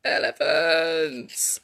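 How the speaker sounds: low, long drawn-out mock call; pretend shout. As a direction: stretch the word out like a story-time shout. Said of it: "What does he shout?".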